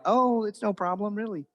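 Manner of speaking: high mock reassurance, softening at the end; put-on polite insistence; falsely polite. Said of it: "you don't really mean it".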